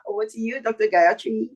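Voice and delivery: normal talking voice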